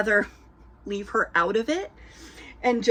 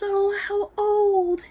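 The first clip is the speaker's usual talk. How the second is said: cooing mom; 12 semitones higher, much slower than usual. Retold or imitated. Stranger mom admiring the baby.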